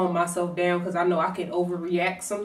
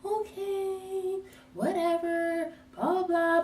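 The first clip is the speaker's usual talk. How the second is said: high, soft dismissive okay whatever; lovestruck shrug-off; 11 semitones higher, 6 dB quieter, slower than usual. Retold or imitated. To her boyfriend, softly giving in again. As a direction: brush it off, love-blind and airy. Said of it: "I'm blindly in love again".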